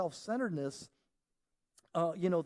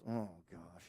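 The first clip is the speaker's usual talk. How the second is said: low, soft deflated groan; a kid's shoulders sinking; dejected sigh; 9 semitones lower, 9 dB quieter than usual. Retold or imitated. Dog told to wait, dejected. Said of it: "He gets dejected".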